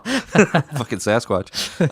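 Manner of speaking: slow, laughing self-mockery. Real, not acted. Podcaster joking about late puberty, laughing. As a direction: crack up while saying it.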